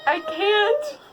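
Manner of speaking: high, play-acted lovelorn refusal; mock anguish. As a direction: refuse with put-on heartbreak. Retold refusal to leave Kate.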